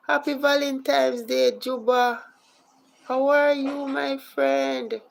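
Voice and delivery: loud, high-pitched excited welcome; overjoyed shout-out to an arriving guest; thrilled greeting. Real, not acted.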